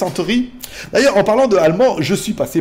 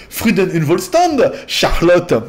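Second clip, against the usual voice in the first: slow, hammed-up German accent; put-on German accent; 3 dB louder, slower than usual. Replying to Charlotte with her sign-off, slow mock German accent. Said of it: "le voici en allemand".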